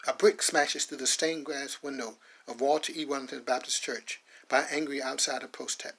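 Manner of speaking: ordinary delivery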